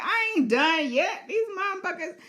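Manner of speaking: high, laughing, defiant dragon voice; the stubborn dragon refusing to retreat; cocky beast laughing off orders. Pretend. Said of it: "And Sheep Stealer's like".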